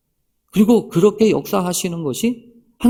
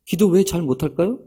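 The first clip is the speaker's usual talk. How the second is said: ordinary delivery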